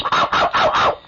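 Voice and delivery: yappy little dog bark imitation; whispery mock dog yelps; corgi yapping. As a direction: yip like a tiny corgi. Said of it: "The corgi goes".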